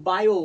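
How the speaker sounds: Bio called out loud and crisp; bio said loudly as the answer; loud demo. Shown as a demonstration. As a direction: announce bio to the class. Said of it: "কি হয়?".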